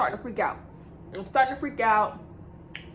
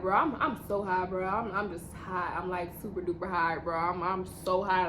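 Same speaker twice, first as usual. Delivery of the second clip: inner voice; 5 semitones lower, 6 dB quieter than usual. Retold or imitated. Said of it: "In my head, I'm just like".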